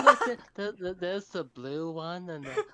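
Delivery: put-on high, laughing kid-like babble; laughing mock voice. Mocking a dragon fan, laughing through it. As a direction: squeal it out while giggling.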